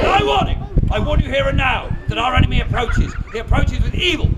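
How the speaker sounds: ringing alarm to the crowd; dramatic warning. Acted. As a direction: warn the whole crowd of danger. Showman warns crowd of approaching enemy.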